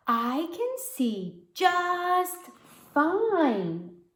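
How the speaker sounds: slow, high, drawn-out whine; stubborn little girl. Acted. Girl refusing the eye doctor, slow stubborn whine. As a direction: stretch each word like a sulky kid. Stubborn child insisting again.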